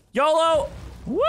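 high, excited cry; daredevil whoop. Real, not acted. Player launching down a slide, whooping. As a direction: shout it out high as you launch.

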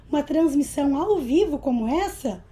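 ordinary talk, nothing marked

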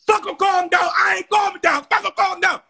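high furious shout; reliving angry yell. Retold or imitated. Shouting back at the police telling her to calm down. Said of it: "the police was saying, "Calm down".

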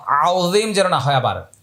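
ordinary talk

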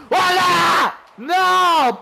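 high, loud gamer yell; real mid-match outburst; shouted reaction. Real, not acted. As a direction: cry out in triumph, then dismay. Shot goes wrong in live match.

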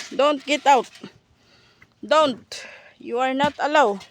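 high, loud mock scolding; mock command. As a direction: order the animals about, jokingly. Playful orders to animals, then charot.

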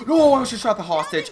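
loud, high startled cry. Real, not acted. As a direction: blurt it out in alarm. Startled by near mistake.

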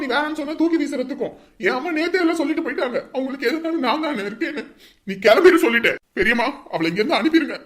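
loud furious tirade; angry shouting. Acted. Son raging at sister over mother, angry shouting. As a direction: rant at her without letting up.

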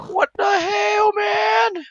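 high, slow outraged cry; disbelieving yelp; shocked. Real, not acted. Shocked outburst.

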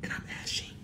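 hushed whisper to the camera. Real, not acted. Whispering her plans to the viewers, hushed. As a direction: breathe it as a secret.

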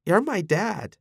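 high, cracking voice; voice squeaking. A narrator's reading. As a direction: voice breaks upward. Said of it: "his voice squeaked".

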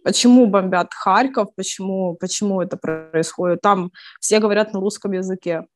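ordinary talk, nothing marked

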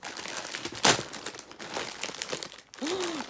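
a long airy gasp of surprise; long delighted gasp. Real, not acted. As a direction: gasp at the candy. Unwrapping candy.

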